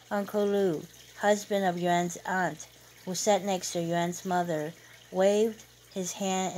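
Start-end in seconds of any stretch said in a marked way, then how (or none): none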